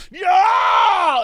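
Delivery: high loud villain outburst; sudden shout. Retold or imitated. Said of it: "says one thing like".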